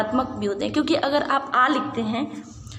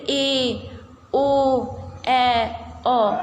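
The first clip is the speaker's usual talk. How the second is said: ए and ओ each held long; drawn-out vowels; much slower than usual. Shown as a demonstration. Teacher lengthening ए and ओ as pluta vowels. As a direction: stretch ए and ओ to triple length. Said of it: "इसमें कहीं ना कहीं ज़्यादा समय लग रहा है".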